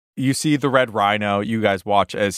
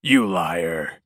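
low, slow heroic menace; growled hero threat; 4 semitones lower, slower than usual. Acted. Red Rhino threatens the lying adult Kenku, low and slow. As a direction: draw out the threat in a lower hero voice.

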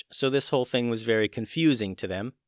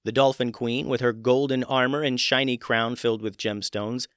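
plain reading voice

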